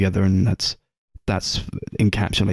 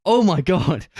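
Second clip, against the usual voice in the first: overwhelmed gasp; 7 semitones higher than usual. Retold or imitated. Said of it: "I was like".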